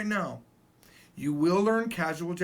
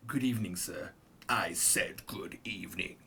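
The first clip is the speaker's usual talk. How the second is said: much lower put-on voice, slowing at the end; dismissive goodbye; 11 semitones lower, 6 dB quieter than usual. Teacher acting a wrong goodbye, mock stern good evening. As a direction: drop deep and draw out the repeat. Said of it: "It's not like a".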